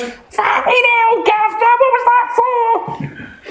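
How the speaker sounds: very high, slow Cockney yelp; hammed-up Cockney cry, very high and slow; punched Cockney. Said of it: "And he goes".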